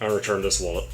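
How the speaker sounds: Liam Neeson. Retold or imitated. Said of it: "Liam Neeson".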